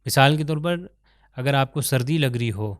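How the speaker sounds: ordinary talk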